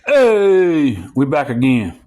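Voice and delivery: loud hey to the viewers; loud greeting. Real, not acted. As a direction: shout a welcome back.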